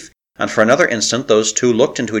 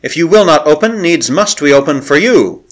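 crying out; 5 semitones higher, 4 dB louder than usual. A narrator's reading. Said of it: "she cried".

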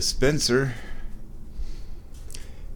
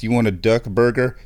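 ordinary talk

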